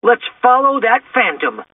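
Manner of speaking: high, urgent call to action; rally the group to give chase; mild rallying shout. A narrator's reading. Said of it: "shouted Fred".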